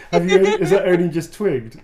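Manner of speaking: high, loud laughing question. Real, not acted. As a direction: laugh through the tease. Teasing S1 about the goose joke.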